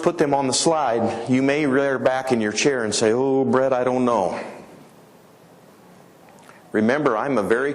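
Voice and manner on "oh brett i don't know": doubtful farmer. Retold or imitated.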